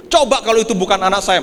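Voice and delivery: ordinary talk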